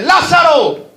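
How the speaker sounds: high, loud, drawn-out call, very slow on sal fuera; Jesus shouting Lazarus out of the grave; commanding shout. Retold or imitated. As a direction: call the dead man out of the tomb. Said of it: "pega su grito".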